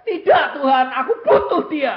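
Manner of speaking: high, loud desperate protest; pleading mother. Retold or imitated. Mother refusing God to keep her child, high loud plea. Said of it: "Dia bilang".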